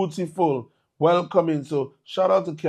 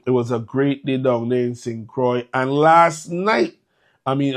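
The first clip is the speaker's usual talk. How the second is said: ordinary talk, nothing marked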